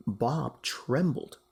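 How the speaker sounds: ordinary delivery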